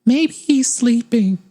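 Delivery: very high, slow, airy and far-off; dreamy Luna. Retold or imitated. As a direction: float the words out in a dreamy daze. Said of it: "It's like".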